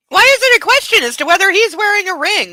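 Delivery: high, loud exasperation; exasperated outburst; indignant. Real, not acted. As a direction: demand an answer. Outraged reaction.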